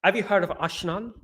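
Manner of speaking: soft mock Dutch accent, "Arshenal". Shown as a demonstration. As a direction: quietly put on a Dutch accent. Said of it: "That's a Dutch accent".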